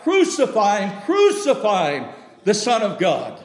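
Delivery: high, ringing shout of the mob; crowd crying out. Acted. Preacher voicing the mob that demands the crucifixion, shouting. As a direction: cry it out like the angry crowd.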